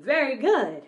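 normal talking voice

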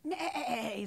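drawn-out, wavering horse call; horse neigh. A narrator's reading. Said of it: "said the horse".

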